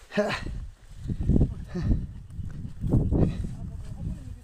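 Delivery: long, high, airy laugh; nervous laughter. Real, not acted. Climber laughs after admitting fear, nervous laughter. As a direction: laugh it out between frights.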